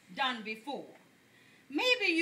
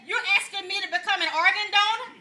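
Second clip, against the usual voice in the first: louder, offended church lady voice; indignant church lady; 5 semitones higher, 8 dB louder than usual. Retold or imitated. Church lady lecturing the pastor, offended and loud. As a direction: play the outraged old church member.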